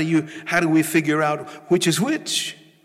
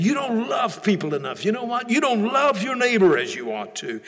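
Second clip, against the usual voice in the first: raised-pitch nagging accusation; accusing voice; 8 semitones higher than usual. Retold or imitated. Devil accusing a praying believer, high nagging reproach. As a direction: scold as an imagined accuser, pitched up. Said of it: "accusing voice".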